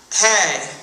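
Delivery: plain reading voice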